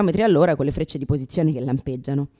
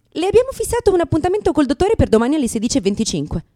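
higher, chirpy official voice; mocking clinic receptionist; prim receptionist; 8 semitones higher, 5 dB louder than usual. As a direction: imitate a bossy receptionist. Imitating receptionist.

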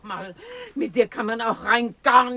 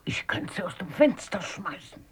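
unvoiced, a touch tearful; grumbling whisper; 7 dB quieter than usual. Oma grumbles to Kalle about the social worker, whispering. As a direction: whisper your frustration under your breath.

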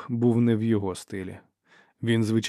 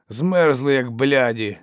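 raised, loud cutting reply; curt retort; 3 semitones higher, 7 dB louder, slower than usual. A narrator's reading. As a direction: cut them off with a loud retort. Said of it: "відрізав Доу". (різка, гучна відповідь)

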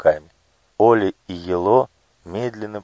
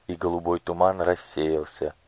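plain reading voice

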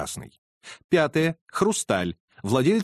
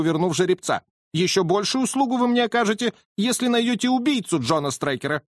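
higher, effusive and eager; grateful colonel; 8 semitones higher than usual. Acted. Colonel thanks Holmes for the horse, higher and effusive. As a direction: gush with thanks.

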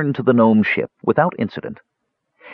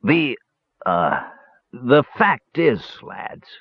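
halting, hesitant start with long pauses; stammering; 3 semitones higher, much slower than usual. A narrator's reading. Dwarf about to confess to the brothers, stammering. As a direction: stall and stumble toward a confession. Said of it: "stammered Dugan".

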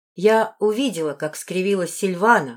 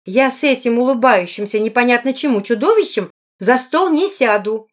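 louder, higher, emphatic refusal; indignant repeat; 4 semitones higher, 6 dB louder than usual. A narrator's reading. A woman repeats her refusal to the lord, more indignant. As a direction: hammer the refusal home, slowing at the end. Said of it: "повторила она".

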